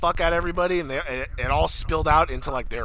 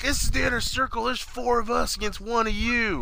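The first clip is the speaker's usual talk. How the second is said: imitating Jericho; 7 semitones higher than usual. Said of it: "Jericho's like".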